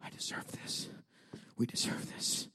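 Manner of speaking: soft, voiceless whisper; inward self-condemnation. Acted. Churchgoer inwardly condemning themselves, whispered. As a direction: whisper it as a private self-punishing thought. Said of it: "inwardly, you're like this".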